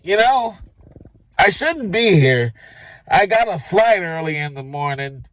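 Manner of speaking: put-on a drunk stranger at the bar admitting he should leave; stranger's voice. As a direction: play the creepy guy casually. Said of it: "He said".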